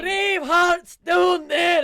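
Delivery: high, drawn-out Scottish war cry; screamed Scottish accent. Retold or imitated. Comic re-enacting a vagina monologue, screamed in Scottish. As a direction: bellow it slowly in a hammed-up Scottish accent. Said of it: "I don't know why you're screaming that".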